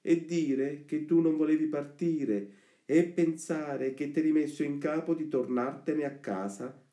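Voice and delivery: put-on high-pitched, insistent boy persuading a friend; Lucignolo. The friend reminding Pinocchio who freed him from school, boastful. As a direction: press the point in a high, boastful voice. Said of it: "ripigliava quest'ultimo".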